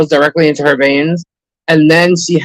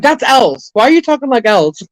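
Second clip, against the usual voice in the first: own retold reply; 8 semitones higher than usual. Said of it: "I'm like".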